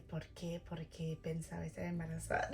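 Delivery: low, hushed mock questioning; imitated nosy question. Voicing viewers prying about the test, hushed. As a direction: ask it low and softly.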